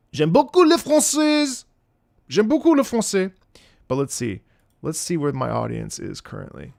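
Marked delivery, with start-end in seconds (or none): 0.1-1.6 high, loud French exclamation; effusive French declaration. Acted. Streamer flattering French viewers, high effusive French. 2.3-3.3 raised-pitch French repeat; effusive French declaration. Acted. Streamer flattering French viewers, raised effusive French.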